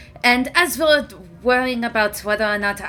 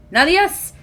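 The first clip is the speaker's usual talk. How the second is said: higher, louder call across the camp; 4 semitones higher, 5 dB louder than usual. Acted. Macaron calling Nalias over, louder and higher. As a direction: raise the voice to summon a friend.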